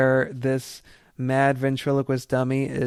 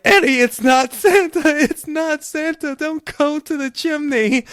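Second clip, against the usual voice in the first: put-on much higher and louder, frantic child's plea; mock panicked kid; 14 semitones higher, 5 dB louder than usual. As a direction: warn Eddie like a terrified kid on the couch. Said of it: "as the kid freaking out on the couch".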